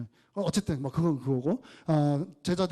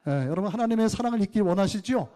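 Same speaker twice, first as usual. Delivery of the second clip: raised, pressing sermon voice; fervent preaching; 5 semitones higher, 4 dB louder than usual. Acted. Pastor exhorting the congregation, fervent preaching. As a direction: urge the congregation with rising fervor.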